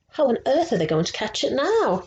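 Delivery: usual speaking voice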